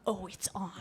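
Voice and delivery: hushed mock threat; menacing whisper. Monkey sister hearing laughter in the bedroom, whispered threat. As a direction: whisper it like a declaration of war.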